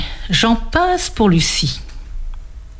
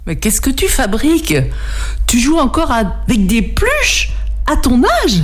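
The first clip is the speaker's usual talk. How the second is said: put-on higher, scornful older sister; mocking big sister; 5 semitones higher than usual. A narrator's reading. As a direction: tease him like a bratty sister. (grande sœur moqueuse)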